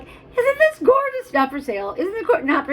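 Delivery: very high, gushing mock show-off voice; mocking other sellers. Seller mimicking people who show off unsellable things, mocking. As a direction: squeal it sweetly, imitating annoying sellers. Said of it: "and they're like".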